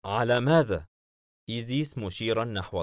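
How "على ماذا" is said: mildly puzzled question. A narrator's reading. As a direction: ask with a touch of bewilderment. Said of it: "حاييم باستغراب". (باستغراب خفيف)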